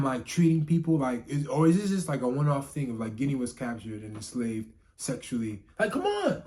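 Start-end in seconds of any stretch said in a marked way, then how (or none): 5.8-6.4 sudden high exasperated outcry. Real, not acted. Fed-up reviewer crying out at the dragons, exasperated. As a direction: throw your hands up, come on.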